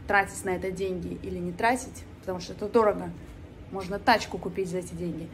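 ordinary delivery

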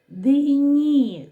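"Дині" stretched high and loud, sound by sound; drawn out for sounding. Shown as a demonstration. Teacher doing sound analysis with children, "Дині" drawn out. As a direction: sing out each sound of "Дині" slowly. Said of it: "Виконаємо звуковий аналіз слова".

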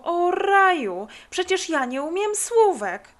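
high, loud wail of dismay; acted panicky realisation; sudden dismay. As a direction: cry out in alarm at what you forgot. Girl suddenly remembers unlearned homework.

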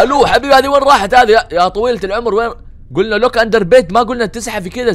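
loud, high, incredulous call. Real, not acted. Player calling after Granny as she leaves, loud and high. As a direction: call after someone who is getting away.

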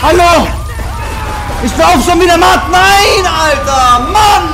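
losing it completely at the game; berserk rage. Real, not acted. Raging at failed mission.

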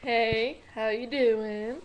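loud, slow greeting. Pretend. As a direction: hail the crowd. Greeting an imagined audience.

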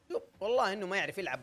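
usual speaking voice

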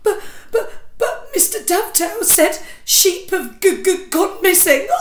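put-on high, stammering child voice near tears; frightened little boy. Little boy sobbing to his mother after a nightmare, high and stammering. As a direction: stutter through the fear like a sobbing five-year-old. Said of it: "hiccuped Bert".

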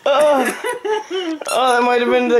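laughing. Real, not acted. Laughing at dad being tickled.

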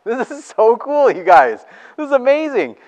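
can't contain the excitement; gushing to the congregation, high and loud; thrilled. Real, not acted. Preacher thrilled by the story.

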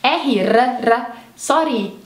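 "R, R, R, sorry" with a trilled, rolled R; rolled Scottish R. Shown as a demonstration. Teacher showing students the Scottish rolled R. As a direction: roll the R hard in "sorry", Scottish style. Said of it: "eles falam R".

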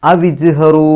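loud അവിജ്ഹറു with a bounced j; echoed consonant. Shown as a demonstration. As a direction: release the j of അവിജ്ഹറു distinctly. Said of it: "എടുത്ത് പറയാം". (അവിജ്ഹറു: ജീം എടുത്തു പറയുക)

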